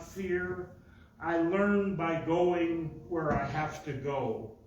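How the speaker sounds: slow, measured poetry reading; poem recitation. Acted. Poet reading Roethke to the audience, measured recitation. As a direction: recite it line by line with deliberate pauses and firm stresses.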